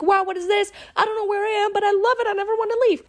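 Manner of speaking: high, breathless wonder at a new world; awestruck wonder. Retold or imitated. You marvel at the planets around you, awestruck. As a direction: gasp it out like a dazzled tourist.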